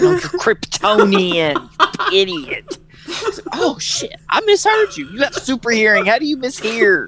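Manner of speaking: high, laughing mock-exasperated father; exasperated dad. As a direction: scold the dumb son in a squeaky, laughing voice.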